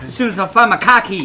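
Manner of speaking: hammed-up Boston "cah keys"; Boston accent. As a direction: drop the Rs like a broad Boston local. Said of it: "I was gonna say Boston".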